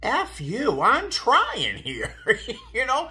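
high, loud, slow snap breaking into a laugh; half-laughing mock outburst at the unhelpful advice; exasperated retort. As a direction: asthmatic snaps back at people telling her to breathe, mock-angry. Retorting to people during asthma attack.

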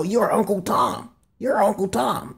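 high, drawn-out taunt; mock name-calling. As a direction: imitate accusers jeering at you. Retold name-calling aimed at him.

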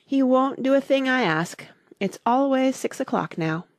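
high, plaintive complaint; mournful. A narrator's reading. Hatter laments Time's refusal to help, mournfully. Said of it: "went on in a mournful tone".

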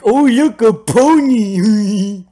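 very high, loud, drawn-out squeal; mock excitement. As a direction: squeal it like an overjoyed kid. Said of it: "I'm like".

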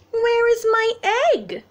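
a hint of a high, sleepy bunny; lightly voiced bunny asking; mild bunny voice. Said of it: "said the bunny".

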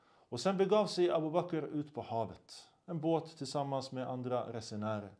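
usual speaking voice

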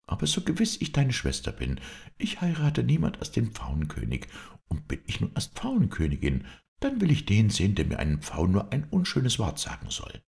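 high-voiced, indignant princess; defiant princess. (trotzige Prinzessin, hohe Stimme)

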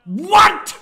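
high, loud disbelieving shout; shocked yell. Real, not acted. Gamer shouting at a bad play. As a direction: scream it in disbelief.

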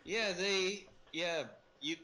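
ordinary delivery

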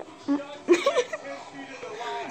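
breathy laugh after a scare; real jittery giggle; nervous laughter. Real, not acted. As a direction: laugh it off, frightened.

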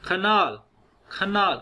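"खनाल" with a short "kuh", stressed "NAAL"; schwa in canal. Shown as a demonstration. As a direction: reduce the first vowel of "खनाल" to schwa. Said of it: "कैनाल नहीं पढ़ना". ("खनाल" में पहला स्वर हल्का अ, नाल पर ज़ोर)

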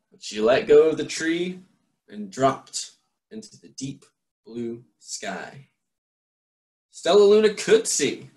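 put-on lively read-aloud voice for children; story narration. A narrator's reading. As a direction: read it like a bedtime picture book. Reads a picture book aloud.

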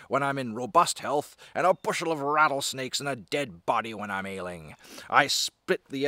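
high-pitched, declaimed frontier brag with shouted outbursts; grandiose, theatrical tough-man bragging; boasting brawler. Acted. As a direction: proclaim each outrageous boast to the crowd. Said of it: "these big guys boasting".

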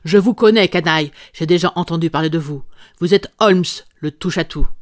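loud, contemptuous tirade; furious insults. Acted. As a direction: spit the insults at him. Visitor insults Holmes furiously.

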